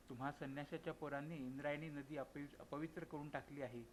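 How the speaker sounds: usual speaking voice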